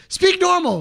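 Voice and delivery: high, loud exasperated order; hammed-up indignant outburst; mock scolding. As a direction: imitate a comedian telling someone off. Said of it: "and he goes".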